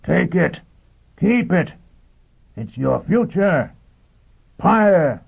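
high shouted bursts to a mob; Foyle roaring. A narrator's reading. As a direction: shout each phrase out separately. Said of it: "he roared to the mob".

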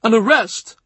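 high, startled question; startled and fearful; alarmed question. A narrator's reading. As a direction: ask it in sudden high alarm. Alarm at the approaching rider.

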